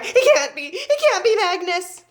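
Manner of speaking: high, loud disbelief; horrified cry. A narrator's reading. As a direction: cry out in rising panic. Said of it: "she cried".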